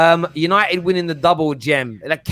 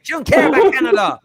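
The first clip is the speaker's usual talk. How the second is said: triumphant jab; 8 semitones higher, 3 dB louder than usual. Real, not acted. Teasing argument.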